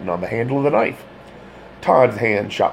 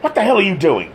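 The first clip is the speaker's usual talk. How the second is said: higher, louder angry demand; 12 semitones higher, 5 dB louder, faster than usual. A narrator's reading. Todd confronting the detective in his kitchen, demanding. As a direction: demand it, outraged. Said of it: "demanded Todd".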